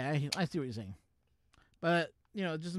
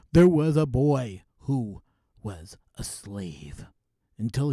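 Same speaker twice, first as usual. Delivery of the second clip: movie intro voice; 3 semitones lower, 4 dB louder than usual. Shown as a demonstration. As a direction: announce it like an epic movie trailer, pausing between phrases. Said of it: "Do a movie intro".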